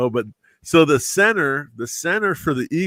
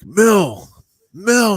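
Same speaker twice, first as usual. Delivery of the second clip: raised call of a name from across the room; 8 semitones higher, 3 dB louder than usual. Retold or imitated. Center waving from the hotel door, calling out. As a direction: call him over, voice lifted high.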